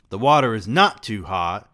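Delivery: loud scream, NOT hammered hard; furious mother screaming, stress on not. A narrator's reading. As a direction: scream it at her son. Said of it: "she screamed".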